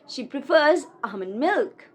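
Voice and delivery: usual speaking voice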